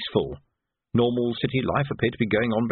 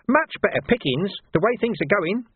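a wheedling gutter lad taking offence; cheeky street urchin Nobby, aggrieved; 9 semitones higher than usual. Acted. Nobby's voice held.

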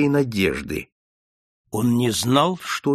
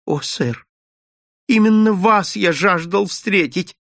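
higher, louder, overjoyed; eager exclamation; 7 semitones higher, 4 dB louder than usual. Acted. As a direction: burst out with relief to Holmes. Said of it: "воскликнул маленький человек". (восторженно восклицает, выше и громче)

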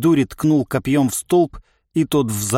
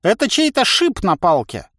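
cackling toothless ranting; 8 semitones higher than usual. Acted. Old man ranting.

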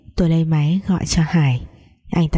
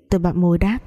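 usual speaking voice

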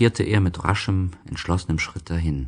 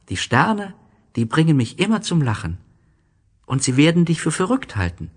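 put-on higher, playful boy's voice; cheerful little prince; 7 semitones higher than usual. A narrator's reading. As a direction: tease your friend with delight. (kleiner Prinz, verspielt und heiter, höher)

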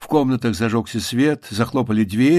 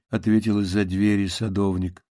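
plain reading voice

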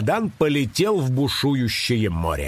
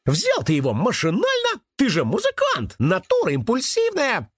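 high, animated rabbit voice; rabbit chiming in; 11 semitones higher than usual. A narrator's reading. Rabbit excusing his musician friend, chiming in high. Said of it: "поддакнул кролик".

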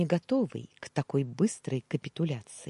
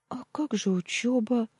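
stammering; 9 semitones higher than usual. Acted. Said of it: "заикаясь, пролепетала она".

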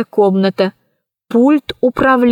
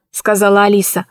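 plain reading voice

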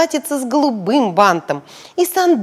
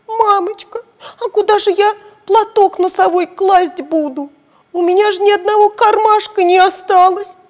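put-on much higher, louder whiny child voice; tearful girl; 10 semitones higher, 6 dB louder than usual. Belochka complaining to mum, mock tearful. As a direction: whimper like a little girl. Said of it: "Белочка заплакала".

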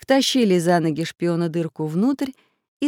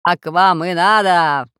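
loud, slow, taunting call; gloating call from above; 6 dB louder, slower than usual. A narrator's reading. Unseen voice gloats over the drowning robbers, calling out. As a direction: call it down slowly and loudly. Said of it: "донёсся голос откуда-то сверху".

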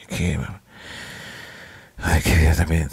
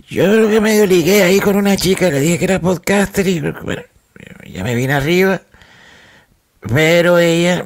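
excited bragging; 10 semitones higher than usual. Real, not acted. Excited boasting about flirting.

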